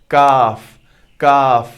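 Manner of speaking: काफ with the mouth open, light and front; light open kaf. Shown as a demonstration. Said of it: "इसका light sound, मुंह खोलना है".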